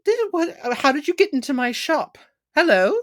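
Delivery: camp satyr. Acted. Satyr scolding the robot in his shop, high and camp. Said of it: "a very camp, very, uh, seventies-esque satyr".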